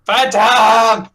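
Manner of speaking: loud high call. Acted. GM calls out the name.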